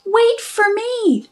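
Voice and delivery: high, tiny mouse calling out; little mouse voice. A narrator's reading. Little mouse calling after her siblings, high and tiny. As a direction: call after them in a small squeaky mouse voice. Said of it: "she would sing".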